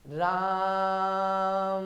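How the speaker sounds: राम held extremely long and slow; very long raam. Shown as a demonstration. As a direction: sustain the आ of राम like a chant. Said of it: "लोग इससे भी लंबा लेते हैं". (राम को बहुत लंबा खींचकर)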